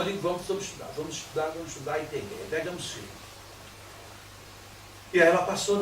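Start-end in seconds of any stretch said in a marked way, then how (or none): 0.2-3.1 softer and lower, coaxing her to study; calm the child, keep it soft and steady; gentle encouragement. Retold or imitated. Father reassuring his crying daughter, soft and gentle.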